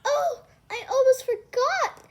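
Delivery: high, loud sudden realisation; startled cry. Acted. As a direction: blurt it out with a little laugh. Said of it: "Cinderella cried".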